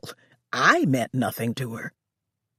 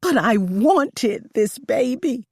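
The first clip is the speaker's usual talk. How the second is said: higher, slow anguished cry; 7 semitones higher, 4 dB louder, slower than usual. A narrator's reading. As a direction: grieve aloud. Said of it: "I cried".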